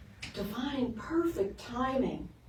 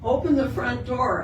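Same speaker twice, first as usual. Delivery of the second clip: ordinary talk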